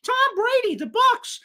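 sarcastic starstruck reporter impression; mocking fawning media voice. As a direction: sneer at the press by gushing in a silly high voice. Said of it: "It said".

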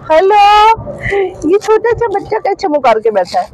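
put-on high sing-song baby talk; mother teasing the baby in the car, high and cooing. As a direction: coo at the little one like to an infant.

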